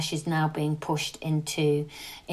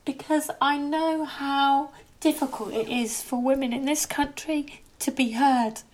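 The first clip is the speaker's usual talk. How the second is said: tearful; 7 semitones higher than usual. Real, not acted. Upset talking about suffering women.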